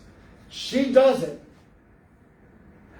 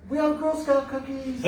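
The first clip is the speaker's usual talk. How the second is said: put-on girl's voice; 6 semitones higher than usual. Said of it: "one girl even goes".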